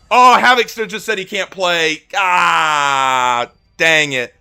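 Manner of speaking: high, loud groan of letdown; disappointed outcry. Real, not acted. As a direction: shout your frustration at the bad news. Friend can't play, disappointed outcry.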